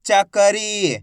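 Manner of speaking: चकरी read out with a long ई; drawn-out long ई. Shown as a demonstration. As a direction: stretch the final री of चकरी. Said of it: "खींच के पढ़ा जाता है".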